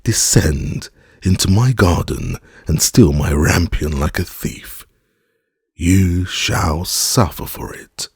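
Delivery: ordinary delivery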